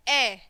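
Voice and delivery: ए as a short isolated vowel; letter sound demo. Shown as a demonstration. Teacher showing children the sound of A, letter sound demo. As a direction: sound out ए on its own. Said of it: "ए की sound कैसे आती है".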